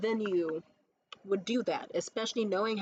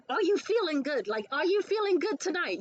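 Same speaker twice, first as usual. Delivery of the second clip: raised, eager rephrasing; high eager question; 7 semitones higher, 4 dB louder than usual. Retold or imitated.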